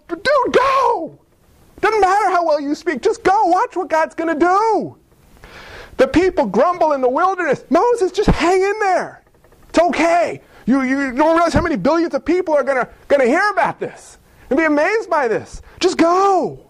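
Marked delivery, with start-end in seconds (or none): 0.1-5.0 high, fast, eager urging; excited coach to Abraham; urgent cheering on. Pretend. Urging Moses to go. 7.7-16.6 higher, earnest pep talk; excited reassurance to Moses; eager encouragement. Pretend.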